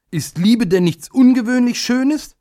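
put-on very high, child-like questioning voice; pleading question. A narrator's reading. Little day wanting to question his scornful uncle, pleading. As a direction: plead upward in a small high voice. Timid child wants to protest.

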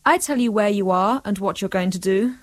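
ordinary talk

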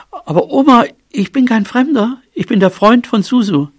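high, eager little voice; earnest, high-pitched pleading; pleading young mouse. A narrator's reading. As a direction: protest to granny in a high voice.

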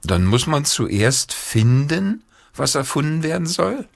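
put-on sighing boy. A narrator's reading. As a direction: draw it out and lift into a question. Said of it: "seufzte Peter".

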